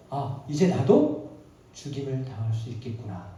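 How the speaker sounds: dawning fear. Acted. Cain realising his danger.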